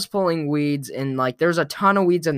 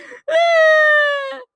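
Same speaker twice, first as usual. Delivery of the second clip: very high, loud sobbing shriek; kid's sob-scream at the end of a tantrum; tantrum wail; 6 dB louder than usual. Retold or imitated. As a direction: let out a child's wounded howl.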